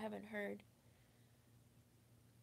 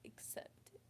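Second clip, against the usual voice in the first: tearful whisper; 13 dB quieter than usual. Real, not acted. Tearful retelling of hug.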